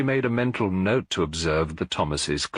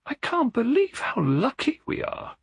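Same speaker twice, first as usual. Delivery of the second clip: lifted voice of a wife in awe of her babies; Eleanor's tender line. A narrator's reading. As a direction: voice her as a hushed, marvelling young mother. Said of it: "she whispered".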